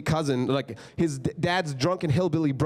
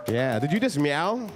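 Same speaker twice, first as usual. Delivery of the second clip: normal talking voice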